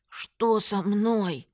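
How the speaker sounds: very slow, slurred demand; stretched-out alarm, lip numb; alarmed numb-lipped friend. A narrator's reading. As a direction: drag out each word through a crooked mouth. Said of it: "набросилась на меня".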